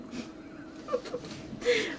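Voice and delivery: high, soft laugh. Real, not acted. Vlogger ends her own lecture, high soft laugh. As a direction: let out a light, high-pitched laugh.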